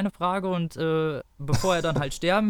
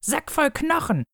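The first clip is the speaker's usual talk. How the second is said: hoch und giftig beschimpfen; 13 semitones higher than usual. Acted. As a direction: schleuder ihm die Beleidigung hoch entgegen.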